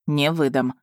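lightly voiced offended snap; a mildly offended retort; a touch of offence in his voice. A narrator's reading. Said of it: "оскорблённо шикнул он на меня".

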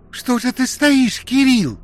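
high, loud desperate female cry; frantic shriek. A narrator's reading. Alena shrieks at Kirill standing still, high frantic scream. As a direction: scream at him in panic. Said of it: "истошно крикнула Алена".

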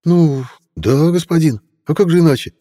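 high eager squire's voice; young boy voice. Acted. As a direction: play the nervous teen squire.

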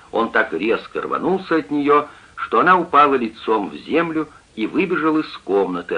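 ordinary delivery, nothing marked

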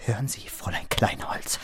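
hissed whisper. Acted. Clerk warns the boss's daughter, whispered. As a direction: whisper it as a threat. Clerk confronts Marie, hushed.

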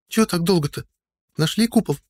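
put-on high impatient young woman; impatient girl voice. A narrator's reading. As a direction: press them impatiently, girlish. Audrey voiced by narrator.

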